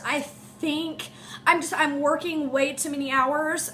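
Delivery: ordinary talk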